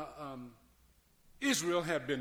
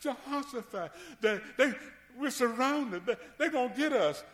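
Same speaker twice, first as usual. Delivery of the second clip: panicked messenger; 9 semitones higher than usual. Retold or imitated. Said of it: "somebody come running to him".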